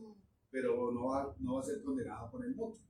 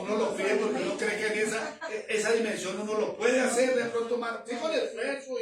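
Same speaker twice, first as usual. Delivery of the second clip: laughing; 7 semitones higher, 6 dB louder than usual. Real, not acted. Laughs while explaining faith.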